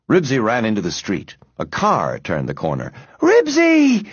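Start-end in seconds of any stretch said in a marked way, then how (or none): 3.2-4.1 high, fearful wail. A narrator's reading. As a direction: wail the dog's name in dread. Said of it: "wailed Henry, afraid to look".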